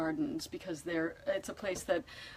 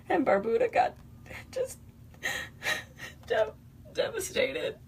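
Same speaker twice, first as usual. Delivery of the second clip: real tears catching the words, pitch cracking higher; voice breaking; 14 semitones higher than usual. Real, not acted. Telling viewers of the devastated islands, voice breaking. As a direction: choke up as the word won't come out.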